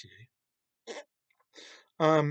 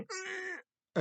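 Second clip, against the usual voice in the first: mock grunt; 8 dB quieter than usual. Mimics casual magic grunt.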